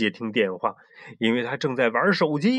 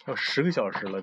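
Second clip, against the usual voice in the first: ordinary delivery, nothing marked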